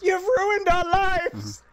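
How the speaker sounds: high, slow, loud hammed-up wail; mock shout. Peasant shouting at departing Elfo, slow high hammed-up shout. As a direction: drag it out as a melodramatic shouted lament. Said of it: "the man just shouts".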